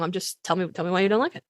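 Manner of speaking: ordinary talk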